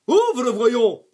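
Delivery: loud angry shout; shouted order. A narrator's reading. Dentist shouting at the clenching boy. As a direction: yell at the stubborn child.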